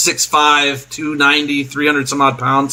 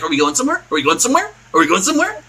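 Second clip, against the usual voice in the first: questions climbing higher with each repeat; eager dog; 5 semitones higher, much faster than usual. Acted. Waggles begging to go somewhere, mounting high-pitched eagerness. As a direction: build like a puppy itching for a walk. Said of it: "starting to get the zoomies looking at you".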